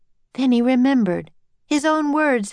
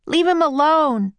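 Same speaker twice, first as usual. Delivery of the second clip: put-on raised boy's protesting yell; 8 semitones higher, 4 dB louder than usual. A narrator's reading. Jack defending the octopus from the captain, yelling. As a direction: yell it firmly, less high than a girl's shriek. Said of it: "yelled Jack".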